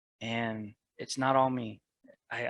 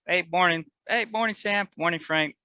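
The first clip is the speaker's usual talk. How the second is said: breezy, louder mock-casual hello; casual passing hello, higher and louder; nonchalant greeting; 5 semitones higher, 8 dB louder than usual. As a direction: toss off the greetings like nothing. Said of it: "it's a nonchalant, like".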